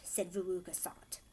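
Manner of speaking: ordinary delivery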